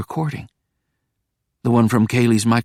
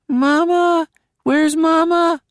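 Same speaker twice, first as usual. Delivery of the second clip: put-on higher, slower child calling for mama; small child; 3 dB louder, slower than usual. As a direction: ask for mama like a lost toddler. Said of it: "My voice".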